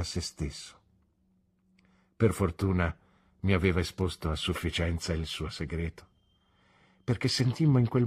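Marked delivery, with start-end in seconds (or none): none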